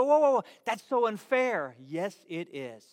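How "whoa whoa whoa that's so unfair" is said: loud, high indignant whoa; mock outraged protest. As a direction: play the listener balking at unfairness. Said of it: "You go".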